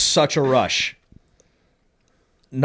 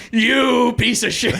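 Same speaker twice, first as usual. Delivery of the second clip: high, drawn-out indignant curse; mock outrage; 9 semitones higher, 4 dB louder than usual. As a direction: spit it at the snitch, stretching the first word. Retold outrage at the snitch.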